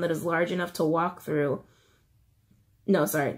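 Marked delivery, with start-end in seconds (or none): none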